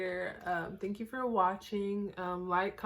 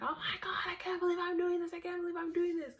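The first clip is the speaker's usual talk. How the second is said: high, breathless excitement; imitating a thrilled kid; excited disbelief; 11 semitones higher, faster than usual. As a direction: gasp it out, thrilled and scared. Thrilled rushing down the hill.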